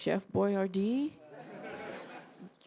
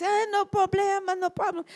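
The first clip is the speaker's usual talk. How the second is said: higher breezy Italian voice; Italian accent; 10 semitones higher than usual. Retold or imitated. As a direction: shrug it off the Italian way. Fellini forgives, high.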